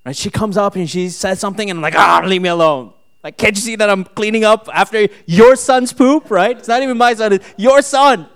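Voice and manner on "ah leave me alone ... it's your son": loud, high retold snapping at his wife; exasperated yell, climbing higher as it goes; hammed-up rant of a fed-up husband; frustrated outburst. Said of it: "I'm getting so frustrated".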